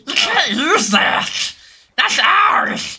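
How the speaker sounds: high, loud gremlin shriek, slow. Retold or imitated. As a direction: scream it like a cackling gremlin. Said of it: "sound like Reptile".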